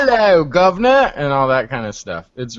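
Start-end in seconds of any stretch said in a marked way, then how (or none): 0.0-1.1 higher, louder mock Cockney greeting; bad Cockney accent. Mocking people trying to be British, bad Cockney accent. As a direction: belt out a bad fake Cockney. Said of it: "do a bad Cockney accent".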